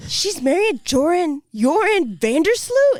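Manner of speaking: retelling stunned alarm at the name; shocked disbelief. Retold or imitated. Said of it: "So I was like".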